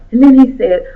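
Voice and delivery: ordinary delivery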